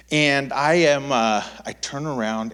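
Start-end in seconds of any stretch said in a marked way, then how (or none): none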